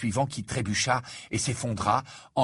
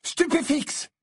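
high-pitched cried-out incantation; shouted spell; slower than usual. A narrator's reading. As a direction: cry the spell out sharply, pitched way up. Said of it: "et s'écria".

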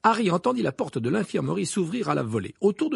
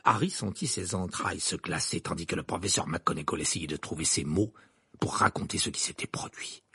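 plain reading voice

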